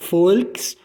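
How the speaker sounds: normal talking voice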